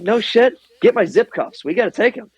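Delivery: high-pitched urgent command, re-enacted; excited retold order. Squad leader orders prisoner taken away, excited. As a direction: act out giving orders in a rush. Acts out his order on capture.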